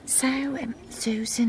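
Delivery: hushed, secretive whisper. Acted. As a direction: lean in and whisper the gossip. Hushed gossip at village show.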